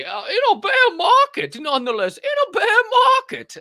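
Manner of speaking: very high, loud wizard-like impression; imitating Grandolph. Host imitating Grandolph's bear market line, high wizard voice. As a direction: squeak it out like a wizard. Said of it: "he does kinda sound like that".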